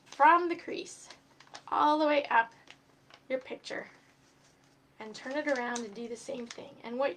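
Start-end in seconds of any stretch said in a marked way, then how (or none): none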